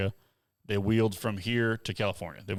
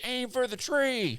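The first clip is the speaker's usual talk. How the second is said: much higher, slow drawn-out call to the driver; imitating a spotter's call; slower than usual. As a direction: call it out from the sidelines, stretched. A spotter shouting advice.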